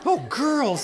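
high, lightly acted scolding; dismayed mommy. Mommy shocked at the girls' language, high mild scolding. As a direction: exclaim it in dismay.

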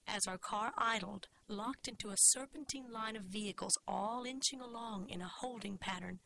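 plain reading voice

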